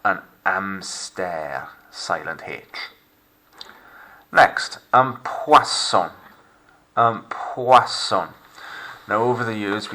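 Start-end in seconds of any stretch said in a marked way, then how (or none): 0.0-1.7 an hamster slow, silent h, French amstair. Shown as a demonstration. Teacher Frenching an English word for pupils, slow. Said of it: "I'm Frenching it up". 4.9-8.3 poisson slow, oi said as wa; French poisson. Shown as a demonstration. Teacher modelling poisson for pupils to repeat, slow. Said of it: "mistaken for the word poison".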